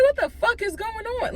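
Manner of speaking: high-pitched, incredulous outcry; exasperated bewilderment. Retold or imitated. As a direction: retell total disbelief with the pitch shot up. Retold bewildered inner reaction.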